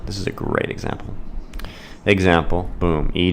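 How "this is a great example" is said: whisper. Acted. Teacher hushes before example.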